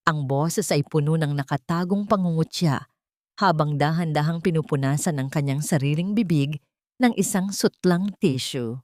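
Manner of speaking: plain reading voice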